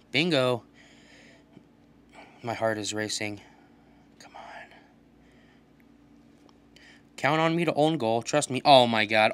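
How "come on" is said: whispered, willing the ball in; hushed plea; tense whisper. Real, not acted. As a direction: breathe it under your breath. Tense moment in game.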